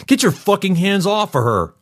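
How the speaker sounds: high-pitched furious outburst; acted protective fury; outraged demand. A narrator's reading. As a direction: cry it out high, as if rushing in to stop them. Imagined furious shout at attackers.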